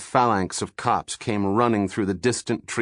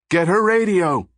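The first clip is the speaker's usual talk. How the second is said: high loud command to grab it; shouted order; 8 semitones higher, 5 dB louder than usual. A narrator's reading. A follower shouts to seize the captain's radio, loud order.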